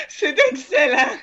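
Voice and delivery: high, laughing praise. Real, not acted. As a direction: laugh through the words. GM laughs at the roll.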